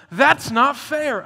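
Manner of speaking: loud, slow, drawn-out complaint; indignant protest. Retold or imitated. Listeners protest the parable, loud and slow. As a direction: drag out every word in protest.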